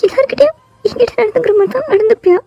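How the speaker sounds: high, crying plea; hurt girl near tears; tearful question. Acted. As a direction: voice breaking as she asks. Heartbroken girl questions her cousin.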